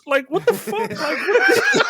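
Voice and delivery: high laughing what the; cracking up mid-sentence; laughing disbelief. Real, not acted. Laughing at the scene.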